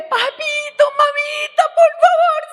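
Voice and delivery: high crying shout to parents; desperate shout. Retold or imitated. Calling to dead parents from the pit, crying shout. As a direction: beg papito and mamita loudly, sobbing. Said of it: "le grité".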